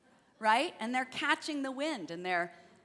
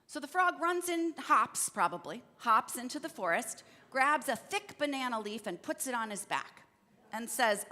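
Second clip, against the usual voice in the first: ordinary delivery, nothing marked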